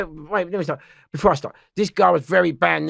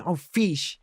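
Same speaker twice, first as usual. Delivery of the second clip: higher, louder mock begging, slowing on the last pleas; imitating pleading friend; 10 semitones higher, 4 dB louder, slower than usual. Friend begging Fish not to get kicked out, mock pleading. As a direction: play the worried pal begging him to stop.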